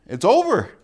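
loud, high punch on "it's over"; emphatic finality. Shown as a demonstration. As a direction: declare "it's over" with force. Blunt end of life's list.

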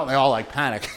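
usual speaking voice

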